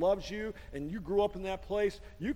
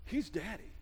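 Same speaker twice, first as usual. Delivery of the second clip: tender reassurance; 6 dB quieter than usual. Retold or imitated. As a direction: gently assure them, quietly. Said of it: "Jesus came along and he said".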